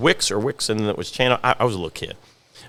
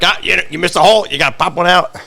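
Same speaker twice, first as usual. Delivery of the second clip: put-on raised, animated old boss's telling-off; scolding grandfather; 6 semitones higher, 3 dB louder than usual. Grandfather to kids who missed a hole, scolding. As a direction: imitate a grandfather catching a kid's mistake. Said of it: "my grandfather would be like".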